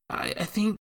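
put-on high, hesitant young boy; stammering child; boy's voice. A narrator's reading. Jacques' boyish voice.

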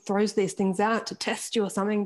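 ordinary delivery

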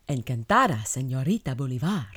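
phrase-book Spanish, no personality; stiff, dramatic telenovela narrator. Shown as a demonstration. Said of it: "sound like a telenovela narrator".

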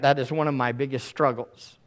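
normal talking voice

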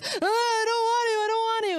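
high mock wail of a child; frightened little girl. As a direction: imitate a child balking in fear. Little girl balking at bigger waves.

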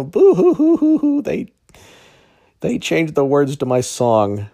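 fake crying. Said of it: "pitiful little snowflake".

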